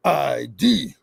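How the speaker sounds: low, drawn-out demand for ID; mock drill instructor command; boot camp sergeant. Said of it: "a boot camp scene or something".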